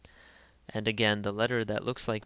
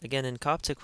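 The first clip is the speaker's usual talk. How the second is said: ordinary talk, nothing marked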